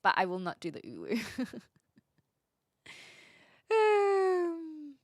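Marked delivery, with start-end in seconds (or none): 3.7-4.9 high, loud, sugary greeting; cutesy baby talk. Pretend. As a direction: coo it like a cute anime girl. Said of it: "Do you have an ara ara uwu voice?".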